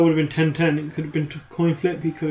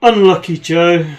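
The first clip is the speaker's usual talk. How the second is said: slow, louder sign-off with teasing pity; drawn-out, louder consolation, tongue in cheek; mock sympathy; 7 dB louder, slower than usual. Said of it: "a good time to say".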